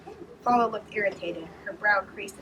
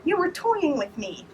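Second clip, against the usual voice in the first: indignant; 9 semitones higher, 4 dB louder, slower than usual. A narrator's reading. Indignant accusation.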